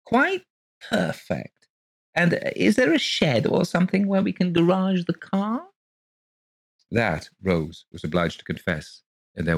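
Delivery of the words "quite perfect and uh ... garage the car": high, gushing genteel lady voice; gushing landlady. Acted. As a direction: pour it out sweetly and breathlessly high. Mrs Colgate again.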